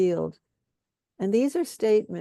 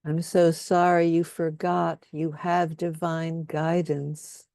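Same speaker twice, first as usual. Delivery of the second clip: guided compassion statements. Acted. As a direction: lay each statement down gently, leaving room for echoes. Leads group in compassion statements.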